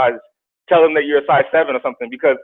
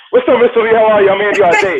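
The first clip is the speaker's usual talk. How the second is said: higher, louder, bright smiling welcome; cheerful salesman greeting; 7 semitones higher, 6 dB louder than usual. Retold or imitated. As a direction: greet the client with a big smile. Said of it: "I was like".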